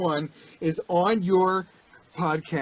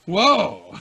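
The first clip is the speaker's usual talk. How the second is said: loud, high startled whoa laugh; startled Pacino whoa; Pacino wake-up whoa; 9 semitones higher, 9 dB louder than usual. Acted. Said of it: "Dude, so good".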